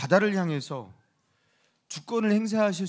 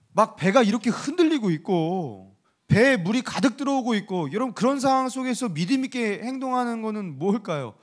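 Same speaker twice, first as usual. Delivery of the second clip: usual speaking voice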